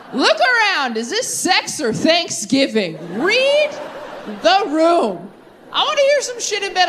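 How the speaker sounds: high, indignant mock telling-off; hammed-up outrage, pitched high; mock scolding. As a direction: scold him like a prim lady. Scolding a partner in bed.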